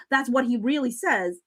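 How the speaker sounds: normal talking voice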